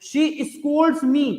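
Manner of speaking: spelled out word by word, very slowly; slow example sentence. Shown as a demonstration. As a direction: dictate it slowly for the class. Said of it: "इन दो sentence को समझ लो".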